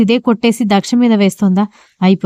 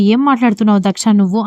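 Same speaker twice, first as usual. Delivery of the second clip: ordinary delivery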